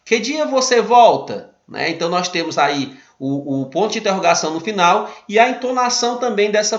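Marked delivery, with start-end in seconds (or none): 0.0-1.5 slow, lifted question tune, a bit louder; questioning intonation. Shown as a demonstration. Teacher reading the example question to students, with a clear questioning tune. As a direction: ask it as a real question. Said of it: "ela tem uma entonação de pergunta".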